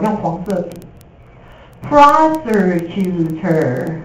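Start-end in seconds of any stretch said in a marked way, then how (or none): 1.9-4.0 slow drill. Shown as a demonstration. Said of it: "这个字超重要".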